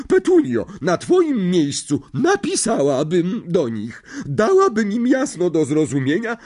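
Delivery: a big domineering aunt who loves her dog; bossy aunt. A narrator's reading. Marge scolds and advises. (władcza ciotka, wysoki głos)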